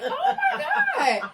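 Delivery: high, loud laughing shout of alarm; half-laughing yell to calm her down. Retold or imitated. Narrator replays shouting at her partner to sit down, laughing. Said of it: "I'm like".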